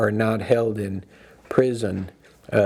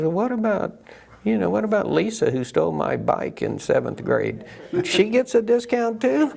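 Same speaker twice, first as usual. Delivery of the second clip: higher, whining voice climbing to a squeal; whiny disbelief; 8 semitones higher than usual. Retold or imitated. Actress in an insurance ad whining about her old bike thief. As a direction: play the whiny actress outraged at the discount. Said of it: "she's always kind of whiny".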